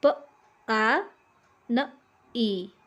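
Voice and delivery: प, आ, न, ई said one sound at a time with pauses; each sound of पानी said on its own; sounds split apart. Shown as a demonstration. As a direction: break पानी into separate sounds. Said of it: "पानी का होगा".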